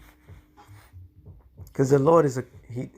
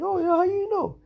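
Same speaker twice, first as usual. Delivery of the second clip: much higher, mocking squeaky voice; mock eager reply. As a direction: parody him as overeager. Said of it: "He's like".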